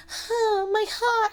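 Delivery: very high, swooning mock lament; mock heartache. Mocking Louis moved by Lestat's story, hammed-up heartache.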